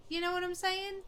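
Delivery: high, rising go at a Scottish accent; attempted Scottish accent. Shown as a demonstration. Gamer showing the route to Irish, attempted Scottish accent. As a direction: try it in Scottish, lifting the pitch high. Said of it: "I have to go through Scottish to get there".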